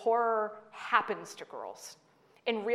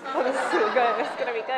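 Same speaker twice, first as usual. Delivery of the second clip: louder, higher gloating glee with a laugh; gleeful boyfriend; 4 semitones higher, 8 dB louder, faster than usual. Retold or imitated. Boyfriend delighting as the clerk explains Creature, gleeful. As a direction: relish the coming show. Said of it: "my boyfriend's like".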